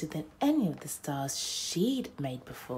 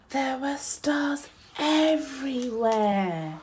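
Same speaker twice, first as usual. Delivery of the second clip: wonder; 9 semitones higher, 6 dB louder, slower than usual. A narrator's reading. Wonder-filled narration.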